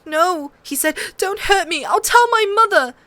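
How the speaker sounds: high, pleading whimper; whimpering fear. A narrator's reading. Prince begs Arya, scared and whimpering. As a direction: beg her not to hurt you. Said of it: "a scared whimpery sound".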